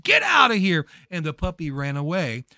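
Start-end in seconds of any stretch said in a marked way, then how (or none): 0.0-0.9 loud, high yell; screamed. Retold or imitated. Lady at the bus stop screaming at the puppy, loud yell. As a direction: scream it to drive the dog away. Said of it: "stomped her foot and screamed".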